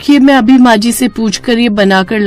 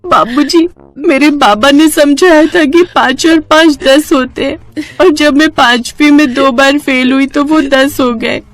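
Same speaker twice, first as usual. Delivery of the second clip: high, sobbing explanation; tearful apology; 6 semitones higher than usual. Acted. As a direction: plead innocently through tears. Weeping wife explains and begs forgiveness. (रोते हुए माफ़ी माँगना)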